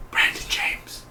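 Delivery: breathless whisper of the name, right after a gasp; shocked whisper. Retold or imitated. Fan realizing the killer's identity, whispered. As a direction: hush it out like you just realized who it is. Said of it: "I was like".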